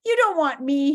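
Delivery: mock sob, begging to be spared; acted tearful begging; sobbing plea. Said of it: "he sobbed".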